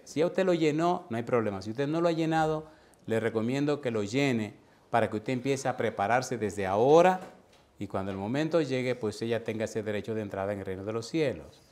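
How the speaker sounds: usual speaking voice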